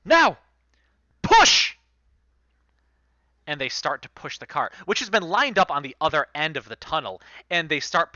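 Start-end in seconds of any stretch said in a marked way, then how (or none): 0.1-1.8 very high, loud command; yells. Acted. Captain yelling at his men to push the cart, loud shout. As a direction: bellow the signal to push. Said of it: "he yells".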